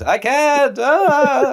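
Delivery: high, wailing comic voice; struggling turtle character. Pretend. Turtle failing to stand on two legs, high mock wail. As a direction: squeal helplessly as a cartoon turtle.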